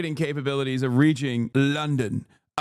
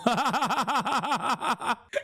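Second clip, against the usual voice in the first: high, rapid-fire cackle; long staccato laugh; real laughing fit; 8 semitones higher, 4 dB quieter than usual. Real, not acted. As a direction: burst into helpless high-pitched laughter. Bursts out laughing at quote.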